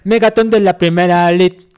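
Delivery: loud commanding general reading. Acted. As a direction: announce it like an army general. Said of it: "que lo lea el general".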